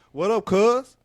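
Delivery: loud, high, hyped-up street greeting. Retold or imitated. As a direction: play the over-familiar buddy. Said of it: "dapping him up like".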